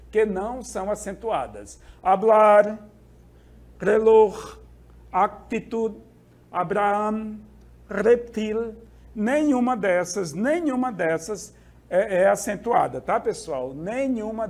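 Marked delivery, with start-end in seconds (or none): 2.0-8.7 hablar, reloj, actitud, Abraham, reptil, each held on its final syllable; teacher's example list: hablar, reloj, actitud, Abraham, reptil, pauses between, last syllables leaned on; stress on last syllable. Shown as a demonstration. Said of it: "essa última sílaba é a mais forte".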